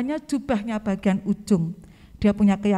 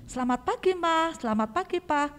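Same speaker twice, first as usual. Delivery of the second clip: put-on child greeting parents; 7 semitones higher than usual. Shown as a demonstration. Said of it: "sudahkah kamu menyapa orang tuamu".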